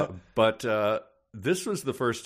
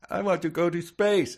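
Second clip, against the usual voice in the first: put-on child voice; 11 semitones higher, 5 dB louder than usual. Child voice in acted exchange.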